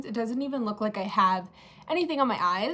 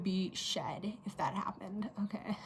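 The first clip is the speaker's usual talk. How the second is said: whispering; 2 semitones lower, 9 dB quieter than usual. Real, not acted. Relief after near mishap.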